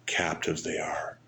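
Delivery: whispered. Acted. Preacher's solemn aside.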